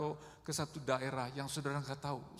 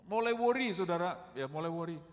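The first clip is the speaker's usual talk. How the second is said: ordinary talk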